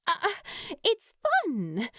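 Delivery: a touch of wounded pride; insulted. Acted. Steed defending her embroidery to Lou, mildly insulted.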